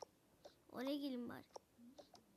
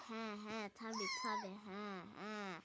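mock agreement; 6 semitones lower than usual. Mocking singsong reply.

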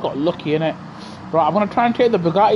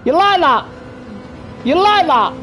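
mock them after the pass; taunting; 7 semitones higher than usual. Real, not acted. Taunting overtaken rivals.

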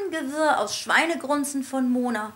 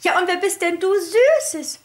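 high, fluttery teacher voice; trilling lady voice; 6 semitones higher, 5 dB louder than usual. A narrator's reading. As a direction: coo it like a delighted lady. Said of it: "hohen Trillerstimme von Frau Schneckmeier".

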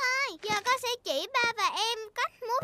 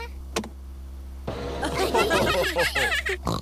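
giggle. Acted. Peppa laughs at magic window.

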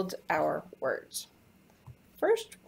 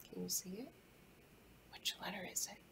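whispering; 16 dB quieter, faster than usual. Real, not acted. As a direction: whisper it, drawing them in. Teacher whispers questions to children.

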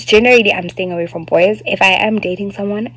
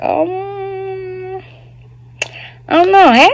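baffled; 12 semitones higher, much slower than usual. Retold or imitated. Confused on the beach.

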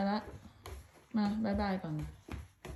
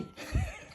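breathy laugh. Real, not acted. Laughs after being startled.

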